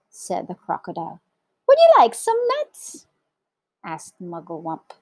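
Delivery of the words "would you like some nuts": very high, loud squeaky monkey; chirpy monkey. A narrator's reading. Monkey offering the crocodile nuts, squeaky.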